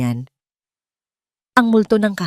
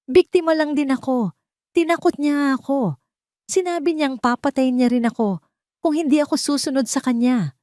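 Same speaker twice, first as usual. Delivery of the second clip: higher, crying plea of innocence; tearful defence; 8 semitones higher than usual. A narrator's reading. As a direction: play the victim through tears. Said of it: "umiiyak din". (umiiyak na pagtatanggol)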